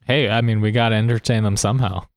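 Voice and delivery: ordinary talk, nothing marked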